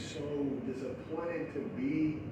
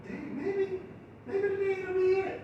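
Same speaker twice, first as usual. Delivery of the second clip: mock hopeful; 13 semitones higher than usual. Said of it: "I'm like".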